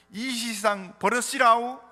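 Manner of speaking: slow, high, loud Jeolla dialect imitation; Jeolla accent. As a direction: stretch it out in a put-on Jeolla accent. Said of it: "전라도 분들은 어떻게 표현하나".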